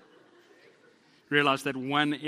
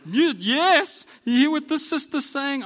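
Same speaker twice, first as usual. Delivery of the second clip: much higher and much louder, hammed-up talk-show hype; Oprah-style talk-show host; 12 semitones higher, 12 dB louder, slower than usual. Pastor mocks wife and clerk, loud talk-show host hype. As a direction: whoop it up like a daytime TV host rallying the audience. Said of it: "It's like an Oprah show".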